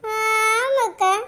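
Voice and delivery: very slow, stretched-out yes; long lingering assent; drawn-out agreement. Acted. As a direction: drag out the agreement to egg on gossip. Agreeing along with neighbour's gossip.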